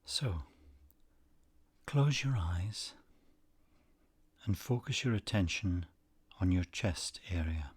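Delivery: unhurried, soothing delivery, letting each phrase settle; guided meditation. Acted. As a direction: guide them gently into stillness, phrase by phrase.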